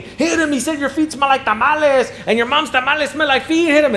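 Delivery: high, excited crowd voice goading the fight; egging on a fight. Retold or imitated.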